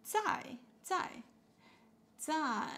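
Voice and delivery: teacher modelling 在 as a falling tone; falling fourth tone. Shown as a demonstration. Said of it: "falling tone".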